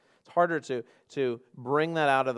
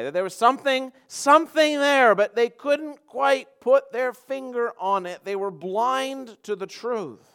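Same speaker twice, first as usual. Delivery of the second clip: high, drawn-out sermon emphasis; emphatic preaching; 11 semitones higher, 10 dB louder, slower than usual. Real, not acted. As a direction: stretch the phrases out with rising force. Preaches on blindness to truth.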